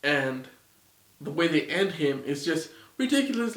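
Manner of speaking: ordinary talk, nothing marked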